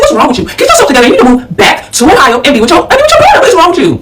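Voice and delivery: high-pitched, indignant telling-off; exasperated scolding. Retold or imitated. Daughter telling mother to move back, exasperated. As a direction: act out scolding, voice leaping up in disbelief.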